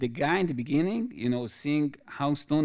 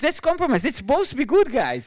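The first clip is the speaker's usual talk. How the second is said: hammed-up friendly waiter; voicing the waiter; 11 semitones higher, 6 dB louder than usual. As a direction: play the waiter high and eager to make peace. Waiter voiced in retold story.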